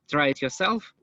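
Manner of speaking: ordinary delivery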